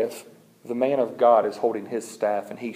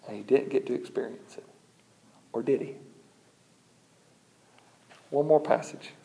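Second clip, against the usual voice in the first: ordinary delivery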